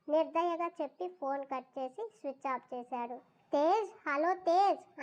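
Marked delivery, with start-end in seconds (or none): 3.5-5.0 loud, higher call into a dead line; shouting madly. Acted. Abandoned girl calling into a cut phone, loud frantic shouting. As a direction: call out his name, frantic. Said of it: "పిచ్చిదానిలా బిగ్గరగా అరుస్తుంది".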